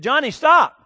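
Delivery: put-on sharp loud call to a child; yelling stop. Mother yelling at her little son to stop, loud and sharp. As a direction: yell it out across the house. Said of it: "So she yelled at him".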